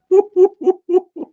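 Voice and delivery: loud, very high-pitched laughter; high hooting laugh. Real, not acted. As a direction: burst out in high, loud hoots. Laughing at the government.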